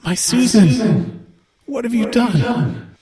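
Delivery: high, slow, anguished mother in the head; a ghostly mother crying out for her daughter; slow high echoing lament from a grieving mother; grieving mother's voice. Acted. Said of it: "what you hear is".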